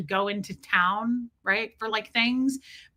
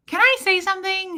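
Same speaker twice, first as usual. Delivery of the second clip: raised quoted voice; 9 semitones higher, 5 dB louder than usual. Retold or imitated. Nurse voiced in retold scene.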